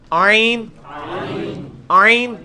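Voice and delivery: عین squeezed from the throat and drawn out; throaty 'ain. Shown as a demonstration. Teacher drills the letter for students to copy, throaty 'ain. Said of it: "یہ عین کے ساتھ ہے".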